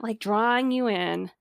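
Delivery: ordinary talk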